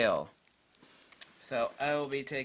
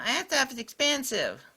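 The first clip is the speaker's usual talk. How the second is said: mocking complainer; 12 semitones higher than usual. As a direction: imitate a whining cheapskate. Mimics complaining cheap buyers.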